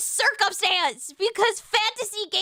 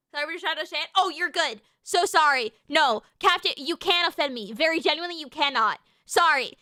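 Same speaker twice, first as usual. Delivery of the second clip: usual speaking voice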